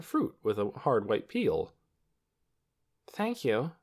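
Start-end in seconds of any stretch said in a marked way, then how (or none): 3.1-3.7 hesitant thanks, pitched up; doubtful. A narrator's reading. Lena takes the strange egg from the doctor, doubtful. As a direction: thank them unsurely. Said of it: "she said doubtfully".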